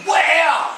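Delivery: high, punchy imitated impact; exclaimed "wham" like a hit; punch sound effect. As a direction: act out the blow landing.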